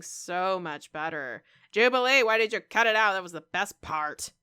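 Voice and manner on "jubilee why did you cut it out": loud, half-joking call to Jubilee; loud indignant appeal to the show. Real, not acted. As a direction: shout the complaint at them. Calling out to the show makers.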